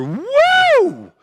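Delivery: very high cheering woo; high whoop. Real, not acted. Comedian cheering Tyler with a high whoop. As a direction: whoop it up for him.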